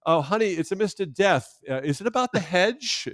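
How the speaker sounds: high, loud mock-British Python line; hammed-up Monty Python English accent. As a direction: play it as a silly sketch. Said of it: "it's always Monty Python".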